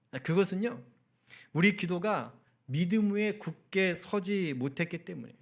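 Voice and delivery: normal talking voice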